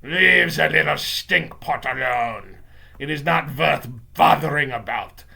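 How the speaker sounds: put-on loud, accented witch dismissal; Grand High Witch shouting. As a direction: call out scornfully as a villain witch. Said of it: "the Grand High Witch was shouting".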